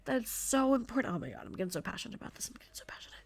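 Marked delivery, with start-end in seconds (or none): 1.1-2.5 fast, breathy whisper aside to herself; real whisper. Real, not acted. Podcast host whispering to herself after getting carried away, fast whisper. 2.5-3.1 barely audible whispered repeat; fading whisper. Real, not acted. Host repeating her aside to herself, nearly silent whisper.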